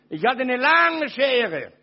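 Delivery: loud, drawn-out boast; loud slow boast. Real, not acted. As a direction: lean hard into the scissors line. Boasting of the scissors.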